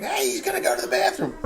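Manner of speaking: high excited laughing call; high laughing shout to the wife; laughing yell. Retold or imitated. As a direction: yell across the house, cracking up. Yelling to wife about toddler.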